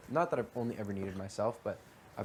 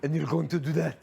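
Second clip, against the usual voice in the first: louder, lightly voiced stern father; mock stern dad; 7 semitones higher, 8 dB louder than usual. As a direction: scold like an overworked dad, hammed-up. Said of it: "he was too busy working".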